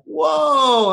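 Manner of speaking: high, loud mock gasp of surprise; retold startled whoa; surprised whoa. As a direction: react like you just heard something outrageous. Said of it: "And I was like".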